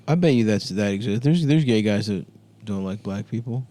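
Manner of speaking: usual speaking voice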